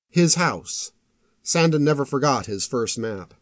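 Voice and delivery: usual speaking voice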